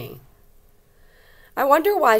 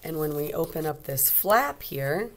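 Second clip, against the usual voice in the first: ordinary delivery, nothing marked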